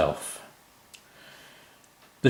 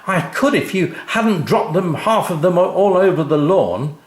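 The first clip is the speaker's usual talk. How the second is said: high, loud retort; shouting back; 9 semitones higher, 6 dB louder than usual. A narrator's reading. Mum shouting back at Dad over the screws, loud and cross. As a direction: snap back at him. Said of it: "who shouted back at him".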